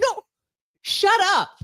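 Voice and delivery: squeaky high teasing protest; playful high shut up. Real, not acted. Hushing the cohost with a squeaky playful yelp.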